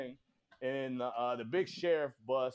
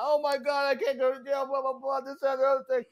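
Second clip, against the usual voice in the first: high-pitched imitation of panicked pleading; mock crying; 9 semitones higher than usual. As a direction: imitate a crying dude, voice pushed way up. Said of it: "You got dudes crying".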